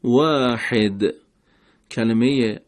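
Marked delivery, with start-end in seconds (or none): 0.0-1.0 واحد with the ha pressed from the throat; Arabic ha from the throat; throaty ha. Shown as a demonstration. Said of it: "حرف حا از حلق".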